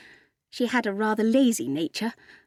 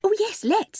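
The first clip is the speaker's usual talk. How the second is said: put-on much higher-pitched girlish agreement; Ethel's eager voice; 11 semitones higher than usual. A narrator's reading.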